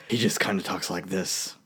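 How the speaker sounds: low, soft Batman without the gravel; understated deep superhero voice; Affleck. Shown as a demonstration. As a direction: drop low and quiet, no growl. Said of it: "I don't even think Affleck does that".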